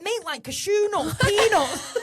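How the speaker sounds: high-pitched mock pleading; exasperated explaining. Shopper explaining nuts to a shop worker, exasperated. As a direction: spell it out, half laughing, pitched right up.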